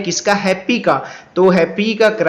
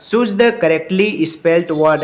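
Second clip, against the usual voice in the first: slow read-aloud; much slower than usual. Shown as a demonstration. Reads question.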